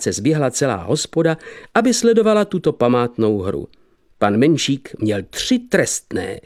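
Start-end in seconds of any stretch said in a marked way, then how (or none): none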